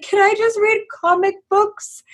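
put-on high-pitched, whiny childlike plea; imitated small-kid pleading voice; pleading child. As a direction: beg Mom like an overwhelmed kid. Acts out pleading child self.

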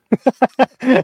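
high, loud cackle; high laugh. Real, not acted. Laughing at his own quip, high loud cackle. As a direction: burst out laughing.

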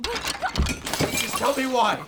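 high, slow, dragged-out scream; furious shout. Acted. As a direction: scream each word apart. Attacking her, shouting.

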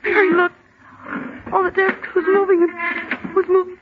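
ordinary delivery

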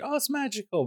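high, mock-gushing wonder. Podcaster mocking a holiday-movie cliché, high mock wonder. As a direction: gush about the magic, tongue in cheek.

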